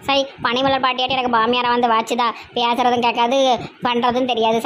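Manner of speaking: low, grumbling village woman; low-voiced daughter-in-law.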